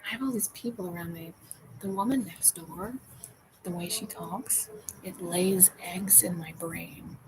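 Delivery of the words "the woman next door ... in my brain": unvoiced, slow whisper; creeped-out whisper. Real, not acted. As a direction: hush it like a secret.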